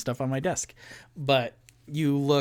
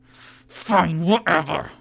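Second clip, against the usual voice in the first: higher, strained grudging surrender; pained giving in; 4 semitones higher, 5 dB louder, slower than usual. Acted. Dwarf with twisted arm giving in, higher and pained. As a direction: give in grudgingly through the pain. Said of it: "and he goes".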